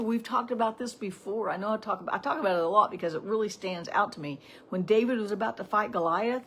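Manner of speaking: usual speaking voice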